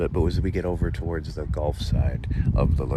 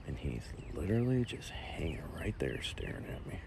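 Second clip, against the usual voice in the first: tense hushed whisper; softly whispered unease; 3 semitones lower, 10 dB quieter than usual. Real, not acted. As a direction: whisper while the snake stares. Snake staring at him.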